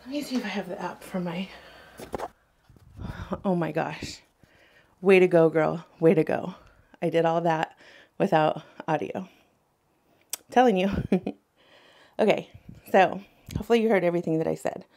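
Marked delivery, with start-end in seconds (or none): 5.0-10.9 loud mock cheer for herself; sarcastic self-cheer. Real, not acted. Mock praise of her own mishap.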